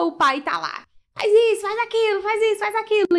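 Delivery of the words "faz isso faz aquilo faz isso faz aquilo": high, mocking nagging-parent voice. Photographer mimics a bossy parent ordering the child, high mocking voice. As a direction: rattle off orders like a pushy parent.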